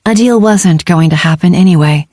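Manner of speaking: ordinary delivery, nothing marked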